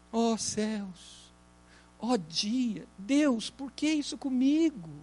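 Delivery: soft, mournful sigh of complaint; lamenting. Retold or imitated. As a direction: moan it like a self-pitying lament. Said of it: "se José resolvesse lamentar".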